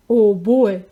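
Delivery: Papa Dinosaur, lightly voiced, booming out a cheerful "oh boy"; loud booming Papa Dinosaur. A narrator's reading. Papa Dinosaur announcing to his family, mildly booming. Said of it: "said Papa Dinosaur in his loud, booming voice".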